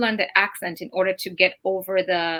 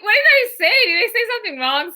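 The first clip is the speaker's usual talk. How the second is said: high, puzzled and worried; retold panic over a wrong word; raised voice, quick and bewildered; confused surprise; 11 semitones higher, faster than usual. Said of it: "And I'm like".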